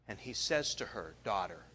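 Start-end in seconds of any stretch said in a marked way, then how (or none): 1.2-1.6 low, soft first "Daughter"; tender call. Acted. Jesus tenderly addresses healed woman.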